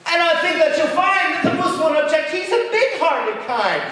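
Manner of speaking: high, loud cheery bug voice; the bug vouching for the moose. Acted. Bug answers spider.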